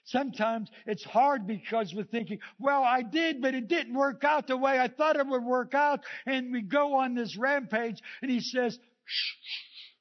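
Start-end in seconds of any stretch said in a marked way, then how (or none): 2.6-6.0 complaining. Retold or imitated. Quoting our complaint to God. 9.0-9.9 unvoiced hush, calming someone down; gentle shushing whisper. God hushing a rampaging believer.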